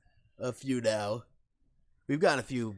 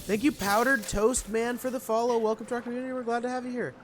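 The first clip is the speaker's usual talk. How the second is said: much higher excited thanks; streamer thank you; 13 semitones higher, 5 dB louder than usual. Real, not acted. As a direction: thank the new follower brightly.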